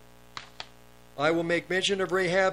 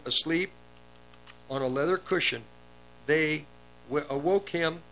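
normal talking voice

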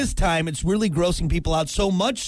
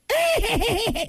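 high cackling witch laugh. Shown as a demonstration. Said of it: "I'm more of a witch laugh".

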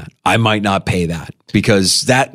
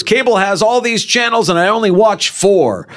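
high-pitched imitation of a common gripe; mock complaint; 10 semitones higher than usual. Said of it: "the complaint was".

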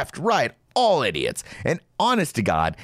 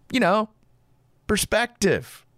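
mock wisdom; 10 semitones higher than usual. Mock sage retort.

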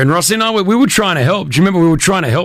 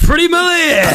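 calling out; 13 semitones higher than usual. Real, not acted. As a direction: shout her name out to the room. Calling her name on air.

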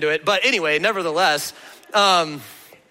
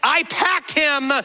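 imitating indignant mom; 7 semitones higher than usual. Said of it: "she's like".

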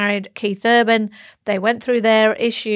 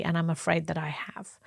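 hushed, regretful whisper; whispered confession; 4 semitones lower, 10 dB quieter than usual. Retold or imitated. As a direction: drop to a whisper on the admission. Quoting statement, whispered line.

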